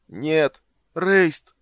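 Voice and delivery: loud, high cry of horror; horrified shout to a brother; anguished cry. Acted. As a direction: call out desperately, voice leaping up. Character cries out as fire strikes.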